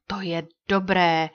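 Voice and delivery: slow, drawn-out cry of approval; pleased exclamation. A narrator's reading. Apache chief welcomes the news that the leader is coming, exclaiming. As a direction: exclaim it slowly, with relish. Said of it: "zvolal Apač a oči mu zasvítily".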